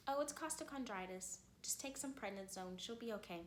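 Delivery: soft, offhand imitation of an unbothered doctor; mock nonchalant reassurance, softly spoken; casual doctor. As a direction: brush it off lightly and quietly. Retold unbothered rheumatologist on phone.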